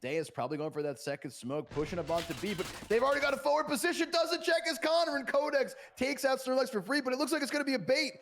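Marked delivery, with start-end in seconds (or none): 2.5-8.1 fast, high, loud hype call; excited. Real, not acted. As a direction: rattle off the action at speed.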